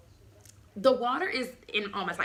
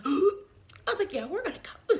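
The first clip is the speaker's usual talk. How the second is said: gasp; 9 semitones higher than usual. Retold or imitated. Biggest contraction hits.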